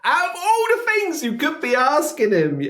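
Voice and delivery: high, laughing disbelief; exasperated laughing. Real, not acted. Reactor outraged at interviewer's question to [name], high laughing disbelief.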